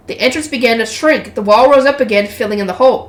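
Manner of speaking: ordinary delivery, nothing marked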